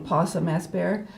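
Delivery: ordinary delivery, nothing marked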